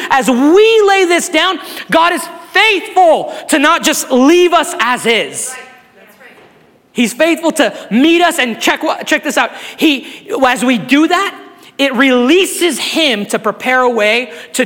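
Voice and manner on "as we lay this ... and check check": rising, louder preacher's cadence, slowing on the big words; preaching at full force. Acted. Pastor pressing the congregation to lay pride down, preaching hard. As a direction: build the sermon up and drive each phrase home.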